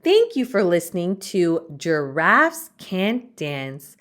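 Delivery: plain reading voice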